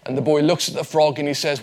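ordinary talk, nothing marked

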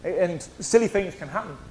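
ordinary talk

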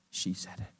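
soft, breathless murmur; mother murmuring along; hushed echo. Retold or imitated. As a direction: say it under your breath. Mother echoing quietly.

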